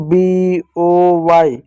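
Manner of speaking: letters B O Y spelt out very slowly; slow spelling. Shown as a demonstration. Teacher to children, spelling out a word slowly. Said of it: "जैसे मैं कुछ example देता हूँ".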